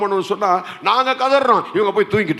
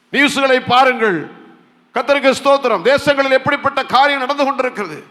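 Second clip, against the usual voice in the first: raised, forceful sermon voice; impassioned preaching; 7 semitones higher, 4 dB louder than usual. Real, not acted. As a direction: press the congregation with urgent appeals. (ஆவேசமான பிரசங்கக் குரல்)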